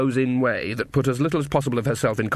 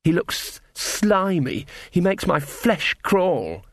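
higher, slow, drawn-out distaste; said darkly; 6 semitones higher, much slower than usual. A narrator's reading. Ella describes the prince to Magrat, darkly disgusted. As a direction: linger on each word with creeping disgust. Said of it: "said Embers darkly".

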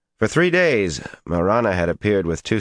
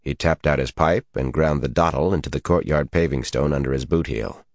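ordinary delivery, nothing marked

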